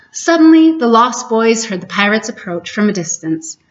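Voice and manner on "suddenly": Suddenly thrown out higher and louder; dramatic suddenly. A narrator's reading. Narrator warns of pirates with a loud, high Suddenly. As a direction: jolt the listener awake.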